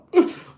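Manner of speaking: sharp gasp for air; loud gasp. Shown as a demonstration. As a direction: gulp air like you can't breathe. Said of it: "You're not out of breath, like".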